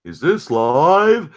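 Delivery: slow, puzzled mock viewer; clueless viewer. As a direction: drag it out like a confused viewer. Imitating a late stream viewer.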